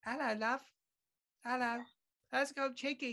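very high English accent; Russell Brand. Shown as a demonstration. Host raising the impression on request, high English accent. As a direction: pitch it right up as a cheeky English comic. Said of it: "make it a little bit higher".

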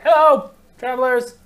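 high, loud eager NPC hello; traveling beggar greeting. Acted. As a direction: call out a bright greeting to strangers. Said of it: "what they ended up saying was".

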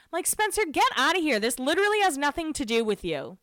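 high, indignant scolding; fed-up rebuke, starting high and easing down; exasperated telling-off. Pretend. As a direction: shoo away an attention seeker, pitched high. Telling Spencer off directly.

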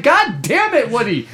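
high mock outburst, falling at the end; acted-out fed-up groaning complaint; exasperated cursing. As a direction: curse the game character in frustration. Acted frustration at broken game.

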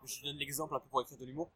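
choking while talking. Real, not acted. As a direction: talk through a choking throat. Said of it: "Je m'étouffe en même temps".

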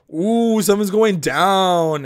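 loud, high, drawn-out ooh; thrilled anticipation. Retold or imitated. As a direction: savour it slowly, relishing the drama. Acts out own thrilled reaction.

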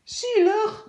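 high, loud scornful "Zielig?"; indignant cleaner. A narrator's reading. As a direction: throw it back in disbelief. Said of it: "roept de werkster". (hoog, luid verontwaardigd "Zielig?")

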